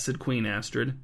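usual speaking voice

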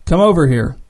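ordinary delivery

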